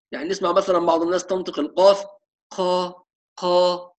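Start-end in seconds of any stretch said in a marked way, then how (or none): 2.5-3.9 قا breathy and softer; قا with a breathy, unvoiced release; whispery qaf. Shown as a demonstration. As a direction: let air leak through قا. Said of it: "فيها همس".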